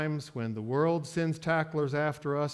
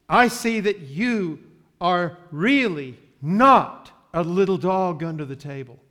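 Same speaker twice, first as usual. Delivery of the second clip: loud affirmation; 9 dB louder than usual. Acted. Jesus affirming the woman.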